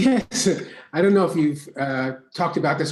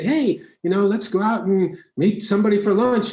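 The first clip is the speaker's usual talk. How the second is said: higher-pitched, eager re-enacted invite; excited invitation; 6 semitones higher, 3 dB louder than usual. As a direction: pitch it up to tempt a friend out. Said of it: "I get excited about it".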